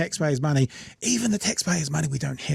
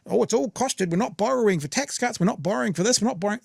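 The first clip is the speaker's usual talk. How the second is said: mocking government voice; 6 semitones higher than usual. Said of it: "mocking government line".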